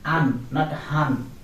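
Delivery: An said without the h, and han with it, to show the wrong way; silent h. Shown as a demonstration. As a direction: say An with no h, then han to show the mistake. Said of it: "not be pronounced".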